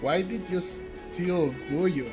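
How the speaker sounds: usual speaking voice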